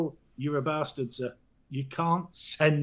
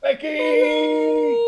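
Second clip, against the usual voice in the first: high, loud calling out; calling out a name in capitals; shouty greeting; 11 semitones higher, 7 dB louder than usual. Real, not acted. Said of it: "I'll get shouty bloody capitals".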